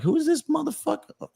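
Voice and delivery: high-pitched mock indignation; indignant ego. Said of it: "my ego would get so in the way".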